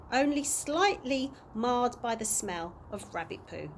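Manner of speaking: ordinary delivery, nothing marked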